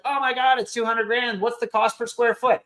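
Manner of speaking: high-pitched imitation of alarmed objectors; mock fretting people. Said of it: "and people are like".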